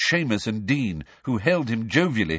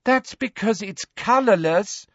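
exasperated; 11 semitones higher than usual. A narrator's reading. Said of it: "in a convincingly exasperated voice".

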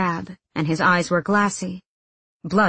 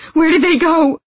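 very high, loud frantic cry; frenzied shout at the top of the range; screamed demand; 11 semitones higher, 8 dB louder than usual. A narrator's reading. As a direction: scream it in desperation. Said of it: "he screamed".

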